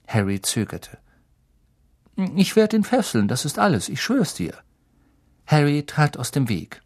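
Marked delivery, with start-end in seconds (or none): 2.4-4.5 squeaky assurance. Acted. Pettigrew's anxious assurance.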